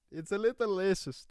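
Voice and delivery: high-pitched put-on Indian accent; hammed-up high Indian accent; mock Indian accent. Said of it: "I was doing an accent".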